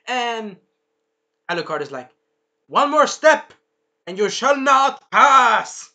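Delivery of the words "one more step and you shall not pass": high, loud dramatic challenge, slowing down; Gandalf. Retold or imitated. Alucard warns the monsters off, loud and theatrical. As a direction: boom it out as a slow, grand warning. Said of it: "kinda like Gandalf".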